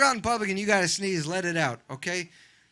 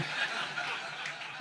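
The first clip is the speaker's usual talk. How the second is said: quiet airy chuckle; breathy laugh; 11 dB quieter than usual. Real, not acted. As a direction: laugh silently under breath. Laughing at own joke.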